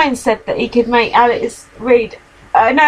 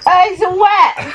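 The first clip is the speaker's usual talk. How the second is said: sudden high alarmed cry; dismayed; 8 semitones higher, slower than usual. Real, not acted. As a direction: caught off guard by a mess. Cat spills something, alarm.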